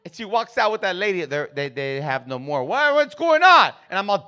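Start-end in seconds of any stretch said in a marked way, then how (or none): 2.6-3.7 high, slow strained demand; agitated dad. Retold or imitated. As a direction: strain upward in alarm. Agitated dad reacting to bad news.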